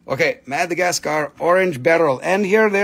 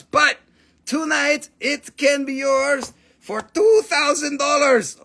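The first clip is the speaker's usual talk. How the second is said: mock TV pitch; 7 semitones higher than usual. Hyping the sale price.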